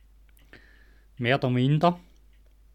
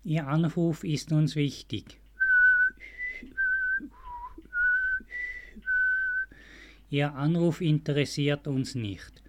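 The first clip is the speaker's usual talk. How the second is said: customer service voice. Pretend. Mock hold-line phone announcement.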